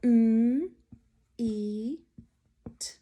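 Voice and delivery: "M, E, T" as separate sounds, mmm, ee, t; sounded out. Shown as a demonstration. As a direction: say each sound of meet alone with pauses. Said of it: "Build the word meet".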